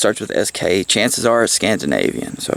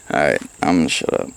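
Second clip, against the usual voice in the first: ordinary talk, nothing marked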